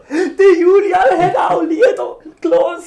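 high loud slow lament through laughter; theatrical wailing. Pretend. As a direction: wail it like a hammy mourner. Mourner wailing at funeral game.

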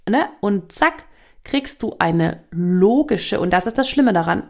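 ordinary talk

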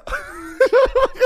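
squeals with laughter; high-pitched burst of laughter; real laugh, way up high; high squealing laugh. Real, not acted. Laughs at own punchline.

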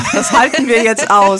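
real laughter while quoting the husband; laughing. Real, not acted. Lacht beim Zitat des Mannes. (lachend und lauter gesprochen)

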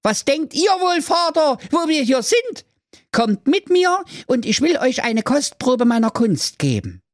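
very high mysterious son voice; youngest son voice. Acted. Youngest son inviting father to see magic, very high. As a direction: lure them along.

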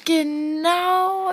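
slow, high sarcastic ja genau; sarcastic drawn-out ja genau. Real, not acted. Daughter mocking her mother's claim, sarcastic drawl.

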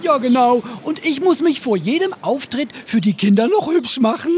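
loud, bubbly clown gushing; excited. Acted. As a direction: squeal it out, all giddy. Kasper gushing about dressing up.

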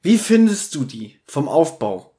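ordinary delivery

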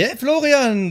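loud, high, cheery hello; excited greeting. Pretend. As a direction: greet him loudly and brightly. Host greets co-host calling in.

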